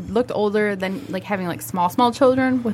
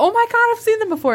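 excited realization; 13 semitones higher, 5 dB louder than usual. Retold or imitated. Retold sudden realization.

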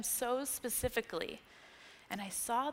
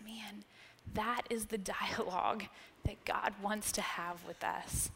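ordinary delivery

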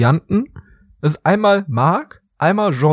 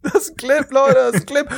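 high, hammed-up streamer cry; mock excited call; 10 semitones higher than usual. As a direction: echo the overexcited streamer voice. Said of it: "irgendwann go".

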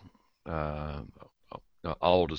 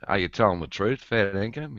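"fair dinkum" lifted as a question; 3 semitones higher than usual. Shown as a demonstration. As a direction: ask it, raising pitch on "fair dinkum". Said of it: "more like a question".

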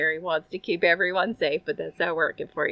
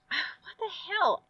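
exasperated sigh; 10 semitones higher, 5 dB quieter, much slower than usual. Retold or imitated. Exasperated retelling.

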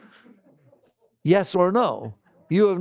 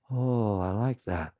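low, soft, slow and satisfied; contented mind; 7 semitones lower, 5 dB quieter, slower than usual. Retold or imitated. The pleased mind accepting the world, low and soft. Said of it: "the mind will go".

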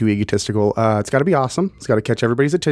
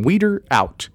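cool guy sign-off, higher; cheesy sign-off; 6 semitones higher, slower than usual. Acted. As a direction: sign off like a radio DJ. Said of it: "Did I really just say Weeder out".

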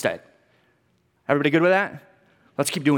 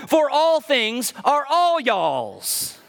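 slow mock-Texan reading, higher; Texan translation; 7 semitones higher, much slower than usual. Shown as a demonstration. Pastor jokingly reading scripture in Texan, slow playful drawl. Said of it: "translates into Texan".

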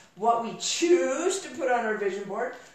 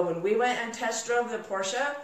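ordinary delivery

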